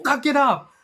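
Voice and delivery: high mock yell, not full volume; imitated curt command; retold director's yell. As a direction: pitch it up like a shouted order. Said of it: "P Kung Fu yell again".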